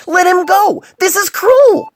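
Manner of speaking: very high, loud frantic yell; maniacal shouting. Acted. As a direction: rattle it out like a man unhinged. Furious demand.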